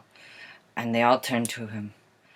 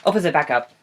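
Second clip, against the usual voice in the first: ordinary delivery, nothing marked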